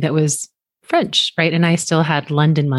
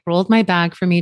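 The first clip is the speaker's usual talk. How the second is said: ordinary delivery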